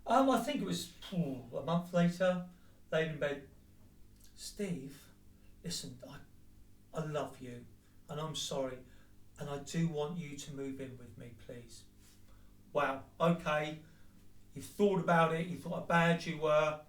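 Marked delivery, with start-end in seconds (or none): 4.4-11.8 hushed, lower, breathy-quiet plea; soft pleading imitation. Imitating his partner's apology in bed, soft and low. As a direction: half-whisper a mock apology.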